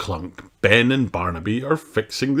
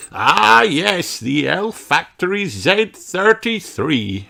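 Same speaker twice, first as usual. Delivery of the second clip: put-on wise old elf; 11 semitones higher, 4 dB louder, slower than usual. A narrator's reading. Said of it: "explains the wise old elf".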